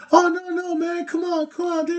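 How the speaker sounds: very high-pitched imitation of begging; mock pleading. Street rapper begging after losing the dollar, mock pleading.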